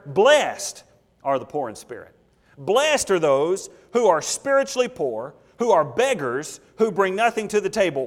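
slow, weighty preaching with key words punched; emphatic preaching. Real, not acted. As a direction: preach the verse deliberately, hammering key words. Preacher expounds verse with full force.

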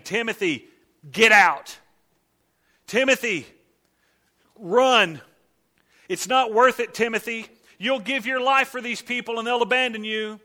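loud, high, urgent pleading; mock frantic warning. Paul imagined urging Timothy to flee, loud and frantic. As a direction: shout a panicked warning to run. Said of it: "That's not the letter he writes".

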